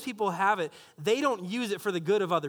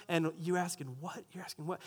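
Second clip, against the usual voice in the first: hushed disbelief; 3 semitones lower, 8 dB quieter than usual. Retold or imitated. Disciples overhear private request.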